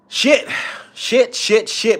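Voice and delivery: high, cracking exclamations. Real, not acted. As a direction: let the voice crack upward on each curse. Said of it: "My voice is cracking because of this shit".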